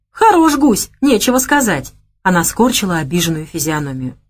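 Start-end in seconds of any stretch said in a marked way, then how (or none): 0.1-1.9 mock indignation. A narrator's reading. Offended woman scolding him.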